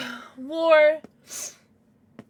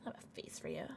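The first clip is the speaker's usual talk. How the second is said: very soft, low whisper; hushed unvoiced aside; 9 semitones lower, 19 dB quieter, faster than usual. Real, not acted. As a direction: whisper it, barely audible. Same line repeated in a whisper.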